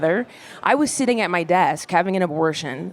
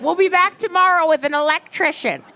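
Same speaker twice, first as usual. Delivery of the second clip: higher, bright landlady voice; chirpy landlady; 9 semitones higher, 3 dB louder than usual. Retold or imitated. Landlady promising to return with an electrician, high chirpy voice. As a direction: announce it breezily.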